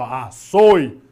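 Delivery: açoite split up, strong middle syllable; stress on SOI. Shown as a demonstration. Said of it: "o som mais forte".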